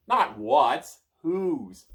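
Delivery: high, loud correction; correcting. Acted. Merlin correcting the child, high and loud. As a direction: snap the correction back.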